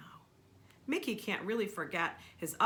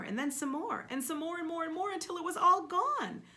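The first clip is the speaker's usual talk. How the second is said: building excitement; 8 semitones higher, 6 dB louder than usual. A narrator's reading. Building repetition, growing louder.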